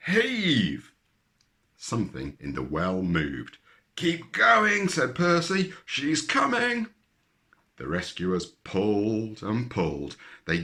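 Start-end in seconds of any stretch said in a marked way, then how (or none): 0.1-0.9 high loud "Heave!"; loud command. A narrator's reading. Percy shouting to the rescuers to heave. 4.0-6.9 high, loud encouragement; excited urging. A narrator's reading. Percy urging the rescuers, high and loud.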